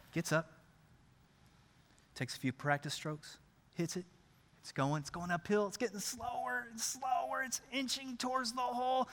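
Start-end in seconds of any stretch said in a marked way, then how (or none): 2.1-9.1 very soft, suspenseful narration; tense greenside hush; hushed commentary. Acted. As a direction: follow the putt with bated breath. Tense hushed account of the putt.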